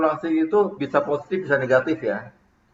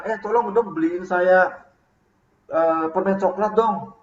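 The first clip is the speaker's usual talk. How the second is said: higher and slower, a hint of a demanding boss; bossy leader's request; 4 semitones higher, slower than usual. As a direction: lightly voice the boss asking a favour. Pemimpin minta dibelikan permen. (atasan minta tolong dengan nada tinggi dan lambat)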